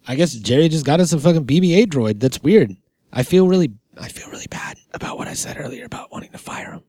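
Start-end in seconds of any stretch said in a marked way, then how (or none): 4.0-6.8 guilty whisper. Real, not acted. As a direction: whisper it so he can't hear. Whispering guiltily near Jerry.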